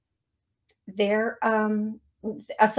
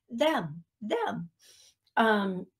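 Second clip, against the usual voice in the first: normal talking voice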